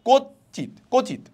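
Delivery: কচিত pitched high on the opening ko; stress on first syllable. Shown as a demonstration. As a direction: lean on the start of কচিত. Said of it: "একটু ঝোঁক পড়বে".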